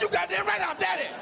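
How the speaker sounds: indignant mock shout; riled up. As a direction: puff up like an offended dad. Dad riled up by son.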